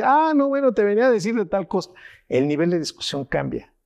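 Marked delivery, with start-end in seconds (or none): 0.0-1.9 high, fast, easygoing; breezy, as if the fight never mattered; mellowed-out partner. Retold or imitated. Partner calmed by chili shrugs off the argument, high and quick. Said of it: "Y te va a decir".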